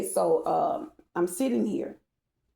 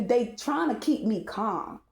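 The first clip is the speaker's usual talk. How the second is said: ordinary delivery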